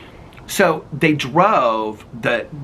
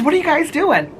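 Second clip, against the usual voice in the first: calling out. Retold or imitated. Neighbor calling out from porch.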